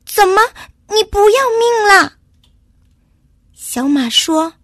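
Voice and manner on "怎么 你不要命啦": high, loud, quick scolding squirrel; alarmed shout. A narrator's reading. Squirrel sees little horse step into river, shouting. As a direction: cry out in alarm at his recklessness. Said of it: "松鼠又大叫起来".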